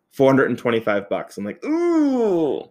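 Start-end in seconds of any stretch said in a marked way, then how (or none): 1.6-2.6 high, drawn-out wincing "ooh"; mock sticker-shock squeal; long high ooh at a painful price; pained ooh.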